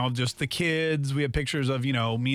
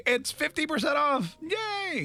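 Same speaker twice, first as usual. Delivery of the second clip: excited; 11 semitones higher, much slower than usual. Pretend. Mock bill announcement, cheering.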